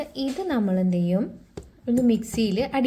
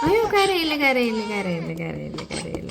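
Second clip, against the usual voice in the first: put-on soothing crying baby. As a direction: repeat it, stepping down in pitch and volume.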